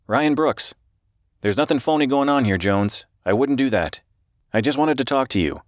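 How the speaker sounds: high-pitched, earnest reporter voice; anxious reporter. A narrator's reading.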